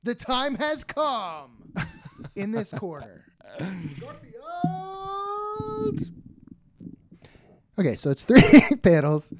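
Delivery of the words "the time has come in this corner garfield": slow booming ring announcer, stretched out; fighting game announcer. Pretend.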